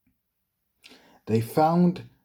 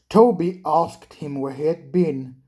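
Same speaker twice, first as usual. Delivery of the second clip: usual speaking voice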